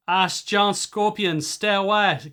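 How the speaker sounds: loud, high, slow warning yell; accented shout. Acted. Man shouting from the house to strangers, loud warning yell. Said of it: "Someone was shouting at him".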